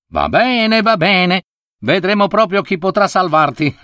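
cocky leopard. A narrator's reading. The leopard scoffs at the monk's call for a trial, cocky. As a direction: taunt him, sure of your win.

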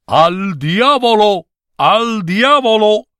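high, drawn-out playful yell; gleeful shout. A narrator's reading. Clerks kicking paper ball in football game, playful shout. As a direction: yell it with each kick of the ball.

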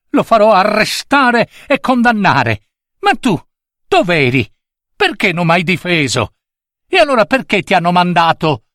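put-on high-pitched, indignant child; angry boy. A narrator's reading. Boy reproaches the servant for not defending him, angry. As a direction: accuse him in a high, cross voice.

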